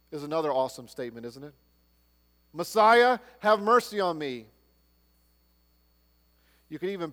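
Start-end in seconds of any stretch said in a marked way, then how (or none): 2.5-4.5 loud, higher plea for mercy; desperate cry. Retold or imitated. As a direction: call out to Messiah, begging. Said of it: "Bartimaeus cries out".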